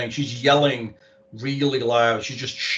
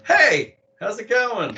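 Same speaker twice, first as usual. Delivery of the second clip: cheery greeting voice; 10 semitones higher, slower than usual. Retold or imitated. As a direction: pop up with a bright hello. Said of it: "and be like".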